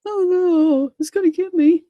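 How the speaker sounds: high-pitched mock panic; Henry. Henry fearing the pirate will get him, high mock terror. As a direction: whimper in hammed-up fright. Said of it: "that one with Henry in his train saying".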